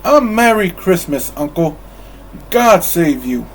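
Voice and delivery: loud, high, cheerful greeting; cheerful boom. Acted. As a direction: boom it out merrily. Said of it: "a cheerful voice boomed".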